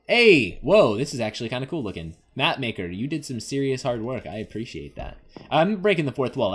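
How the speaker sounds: ordinary talk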